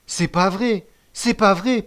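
high, loud, frantic denial; protesting. A narrator's reading. Accused boy denying the betrayal, high protest. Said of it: "protestait de nouveau Bacayé".

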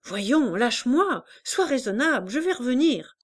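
a hint of an exasperated mother; impatient mother. A narrator's reading. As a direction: hurry and brush her off. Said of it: "répétait celle-ci".

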